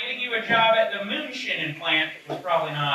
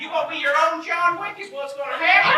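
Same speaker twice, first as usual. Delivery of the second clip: put-on high-pitched old woman scolding a dog; 9 semitones higher than usual. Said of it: "an old woman".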